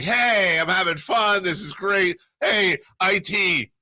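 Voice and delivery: high, excited mock owner shout; giddy new owner. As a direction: call out like a thrilled new boss having fun. Voicing the new owner partying.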